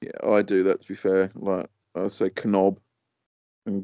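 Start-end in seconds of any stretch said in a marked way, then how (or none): 2.4-2.8 "Knob" with a hard K, kuh-nob; silent letter sounded. Shown as a demonstration. Co-host admits he does it too, "Knob" as kuh-nob. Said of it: "pronounce, like, silent letters".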